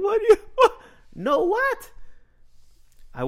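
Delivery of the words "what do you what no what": very high, cracking in disbelief; baffled squeak. Retold or imitated. Teen baffled by mom's no.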